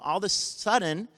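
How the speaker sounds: ordinary delivery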